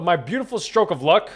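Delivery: ordinary talk, nothing marked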